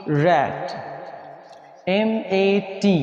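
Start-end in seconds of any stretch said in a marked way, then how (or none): 0.1-0.7 "रैट" with an open short "ऐ" vowel. Shown as a demonstration. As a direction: say "रैट" like English rat. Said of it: "ऐसे हम पढ़ेंगे".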